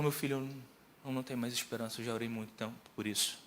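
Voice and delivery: low, very soft resignation; retold sadness. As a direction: imitate a mother who has given up hope. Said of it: "mas com semblante triste".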